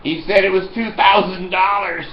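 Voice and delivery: ordinary talk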